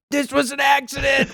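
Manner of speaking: a hammed-up shriek of the line; mock scream. Joke about Buckley's mom at the birth, in a mock scream. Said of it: "was screaming".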